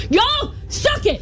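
high, slow wrestling taunt; Degeneration X taunt. Acted. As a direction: yell it like a wrestler. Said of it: "She went full Degeneration X".

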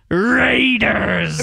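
drawn-out announcer Raiders; Chris Berman Raiders call. Retold or imitated. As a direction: stretch it like a TV sportscaster. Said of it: "he puts on that".

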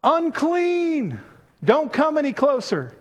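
high stretched call of unclean; leper's warning cry. Retold or imitated. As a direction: warn them off from afar. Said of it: "what should they have said?".

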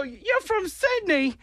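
high, slow, surprised stranger; surprised question. Retold or imitated. Australian couple hearing he's from Sydney, slow surprised voice. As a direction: draw it out in disbelief.

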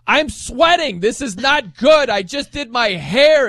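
high, loud, slow mock whining yell. Host imitating Lorena yelling at her coach, hammed-up high whine. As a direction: ham up a diva yelling about her hair. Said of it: "you're yelling at your coach like".

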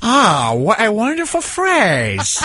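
slow put-on Korean accent; hammed-up slow Korean accent; mock Korean accent. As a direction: marvel at the phrase slowly in a parody Korean accent. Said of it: "This is gonna be good with Korean".

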